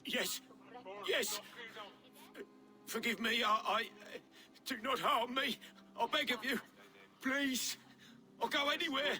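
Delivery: high, trembling begging voice; a frightened king pleading not to be harmed; pleading in fear. Acted. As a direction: beg for your life, voice cracking. Deposed king begs for his life.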